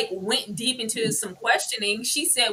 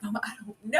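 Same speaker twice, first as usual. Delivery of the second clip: put-on high, soft, giddy girl voice; teenage schoolgirl; 11 semitones higher, 6 dB quieter, much faster than usual. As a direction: play a coy schoolgirl confiding in mama. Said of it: "turned into a teenage schoolgirl".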